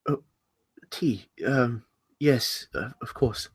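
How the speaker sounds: soft, hesitant, halting reply; confused. Acted. Host reminded of tea by guest, confused.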